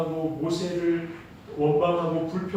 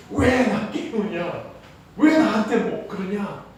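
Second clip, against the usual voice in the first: higher-pitched mild mock scolding; acted rebuke; 5 semitones higher, 4 dB louder, slower than usual. Woken Jesus scolding his disciples, acted rebuke. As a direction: complain at being disturbed, retold.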